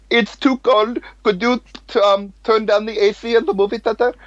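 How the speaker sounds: very high put-on Indian accent; imitated Indian accent. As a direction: make a polite request in a high mock Indian accent. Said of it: "another Indian person comes out".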